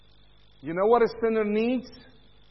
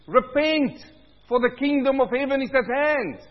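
preaching call to repent; 7 semitones higher, 4 dB louder than usual. Acted. Quoting John the Baptist.

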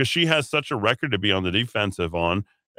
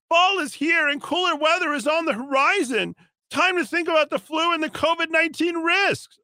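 much higher, hammed-up prim official voice; mock official voice. Mocking the health secretary's vaccine announcement, hammed-up high voice.

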